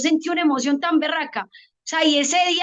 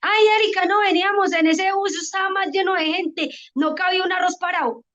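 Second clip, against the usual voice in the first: higher-pitched voice of a friend complaining; chatting friend; 4 semitones higher than usual. Retold or imitated. Friends chatting behind the scenes about a packed bus, higher-pitched imitation. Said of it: "cuando están conversando los amigos míos".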